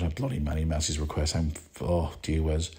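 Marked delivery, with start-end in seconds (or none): none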